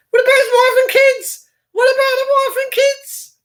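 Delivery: high, loud outraged cry; indignant outcry. Real, not acted. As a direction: throw the question out in disbelief. Outraged rhetorical mock cry.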